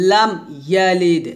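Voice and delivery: ordinary talk, nothing marked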